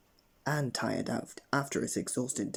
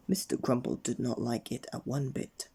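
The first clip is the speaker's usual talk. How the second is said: ordinary delivery, nothing marked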